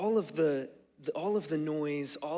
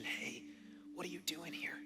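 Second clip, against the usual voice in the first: very soft, whispered question; God's gentle whisper; 2 semitones higher, 12 dB quieter than usual. Acted. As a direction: ask tenderly, barely above a breath. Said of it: "can you hear God asking you".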